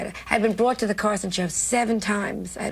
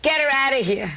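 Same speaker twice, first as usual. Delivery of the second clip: raised, higher mock command; retold order over loudspeaker; 5 semitones higher, 4 dB louder than usual. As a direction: bark it like the angry owner. Owner ordering her offstage, retold.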